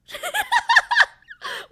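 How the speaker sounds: very high, loud burst of laughter; high shrieking laugh. Real, not acted. Streamer cracks up after acting cute for the camera.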